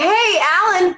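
bright, high-pitched nervous "Hey, Alan" into the phone; high nervous greeting. Retold or imitated. Late actress greeting the casting director, high and nervous. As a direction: chirp it up high, eager and anxious.

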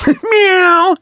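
high-pitched laughing meow; squeaky imitated cat cry. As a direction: let out a cat's meow. Cat answers the wife.

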